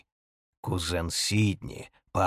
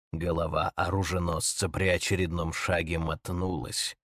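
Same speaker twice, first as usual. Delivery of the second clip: plain reading voice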